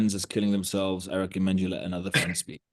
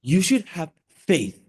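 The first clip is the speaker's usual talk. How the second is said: You should have faith, slow, higher, louder; emphasis on faith; 8 semitones higher, 5 dB louder, slower than usual. Shown as a demonstration. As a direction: lean hard on faith. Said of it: "put more emphasis on the specific words".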